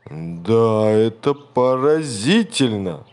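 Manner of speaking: slow, loud astonished beast; exclaiming monster. A narrator's reading. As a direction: boom out your amazement. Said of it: "воскликнул страшный зверь".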